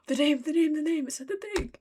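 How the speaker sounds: high, loud fangirl outburst; real giddy high-pitched excitement; excited squeal. Real, not acted. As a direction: squeal with delight, starting loud. Excited at hearing the title said.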